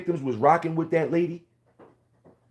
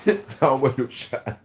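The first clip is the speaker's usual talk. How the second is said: slow, chuckling put-on take; laughing mock repeat. Repeating his own line to viewers, slow and laughing. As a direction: laugh through it like a joke.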